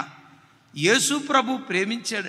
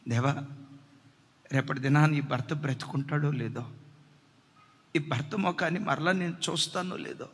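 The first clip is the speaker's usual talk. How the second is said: tender prayer; 5 semitones lower, 3 dB quieter than usual. Acted. Wife's prayer acted in sermon.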